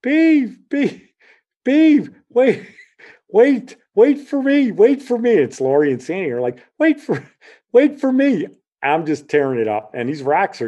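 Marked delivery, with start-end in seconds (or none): 0.0-5.3 put-on high, loud girls calling after someone; shrill-pitched pleading holler; imitated yelling. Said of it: "they're yelling". 6.8-8.5 high, loud calling out; high-pitched pleading yell, imitated. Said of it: "they're yelling".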